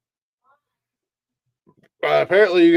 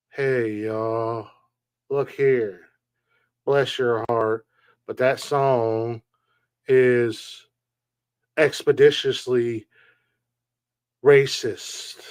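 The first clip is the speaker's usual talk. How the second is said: slow, lightly put-on Southern drawl, mocking the TikTok woman; mock Southern accent; 3 semitones lower, much slower than usual. Mocking the up-north woman who fakes a Southern accent, slow mock Southern drawl. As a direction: imitate a fake Southern accent, dragging the words out slowly. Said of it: "she fakes a Southern accent".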